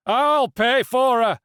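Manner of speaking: slow, loud high call; man in the crowd offering coins. A narrator's reading. Another man steps forward.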